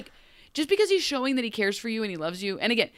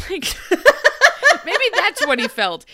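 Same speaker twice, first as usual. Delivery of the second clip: laughing; 9 semitones higher, 10 dB louder than usual. Real, not acted. Laughing mid-rant about love.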